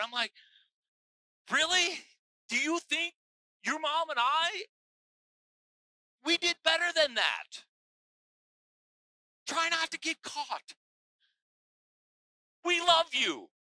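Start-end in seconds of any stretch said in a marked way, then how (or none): 1.5-13.5 higher, louder teasing parent; mock scolding parent. Parent teases child caught lying, higher and louder. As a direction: tease the kid for a clumsy lie.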